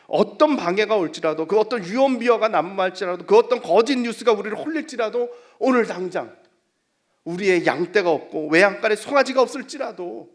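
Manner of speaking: loud, building list in a sermon; full-force preaching. Real, not acted. Preacher rallies congregation against every obstacle, full-force preaching. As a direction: hammer out each clause.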